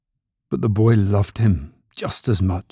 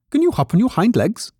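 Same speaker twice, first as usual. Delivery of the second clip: high-pitched rabbit character voice; challenging furry rabbit; 12 semitones higher than usual. A narrator's reading. Furry rabbit testing the toy rabbit, high challenging voice. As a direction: quiz them in a high rabbit voice.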